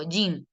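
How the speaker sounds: JEAN as "yin", short and falling; English pronunciation. Shown as a demonstration. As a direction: say Jean like the gringo, one ee vowel. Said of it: "¿cómo lo pronuncia el gringo?".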